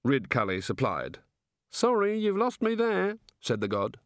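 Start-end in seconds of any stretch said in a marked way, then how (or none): 1.7-3.2 innocent, measured wondering; curious, genial god. Acted. God asking wizards how it all works, innocently curious.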